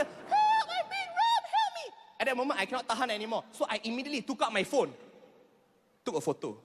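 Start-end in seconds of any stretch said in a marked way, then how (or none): 0.0-1.9 higher falsetto shriek for help; hammed-up lady screaming harder; mock louder cry for help. Comic mimics lady screaming louder, high falsetto shriek. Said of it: "she screamed even louder".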